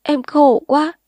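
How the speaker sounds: put-on a young woman bursting into tears to her lover. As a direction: break down crying as you say it. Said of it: "Sinh òa lên".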